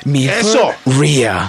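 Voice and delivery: slow, drawn-out gringo Milforria; American accent spanish. Retold or imitated. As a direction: stretch it out like a gringo. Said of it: "no, con acento gringo".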